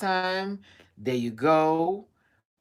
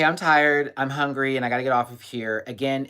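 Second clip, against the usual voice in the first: ordinary talk, nothing marked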